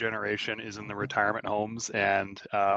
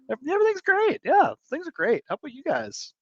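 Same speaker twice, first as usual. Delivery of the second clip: much higher, fake-cheerful; sarcastic falsetto. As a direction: squeak out forced cheer. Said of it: "Dripping with sarcasm".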